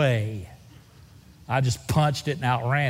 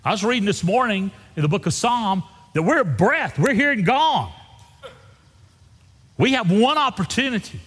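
passionate preaching; 7 semitones higher than usual. Acted. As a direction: preach it with urgency. Preaching at full force.

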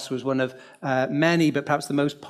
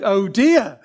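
flustered oh dear; 4 semitones higher, 7 dB louder than usual. Retold or imitated. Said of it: "I think I said something like".

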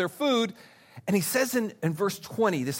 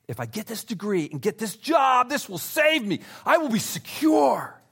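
desperate cry; 5 semitones higher than usual. Retold or imitated. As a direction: cry out higher as if grasping for safety. Voicing idol-seeker's desperate hope.

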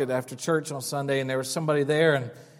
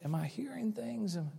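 unvoiced, hushed self-question; whispered thought; 13 dB quieter than usual. Alone at night, wondering if he heard screams, whispering. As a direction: whisper it to yourself in doubt. His own doubting thought.

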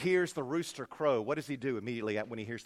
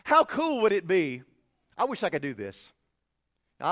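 ordinary talk, nothing marked

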